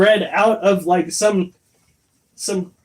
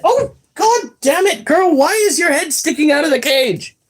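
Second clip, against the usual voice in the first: much higher exasperated pet-scold; 9 semitones higher than usual. Real, not acted. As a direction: fuss at the bird poking its head out. Scolding her pet bird.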